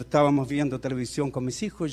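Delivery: ordinary talk, nothing marked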